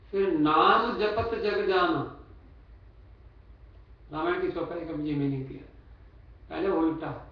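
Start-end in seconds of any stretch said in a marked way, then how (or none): 0.3-2.2 slow, stretched नाम, then जपत जग जाना falling away; separate phrase after pause. Shown as a demonstration. Preacher showing the verse's second half, नाम slow and emphatic. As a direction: draw out नाम as a fresh start. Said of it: "stop, रुक जाओ".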